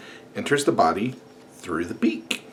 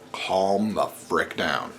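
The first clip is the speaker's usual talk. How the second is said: slow, deliberate; 3 semitones lower, 5 dB quieter, much slower than usual. Acted. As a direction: drag out calm the frick down slowly. Vlogger telling critics to calm down.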